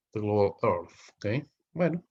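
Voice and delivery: ordinary talk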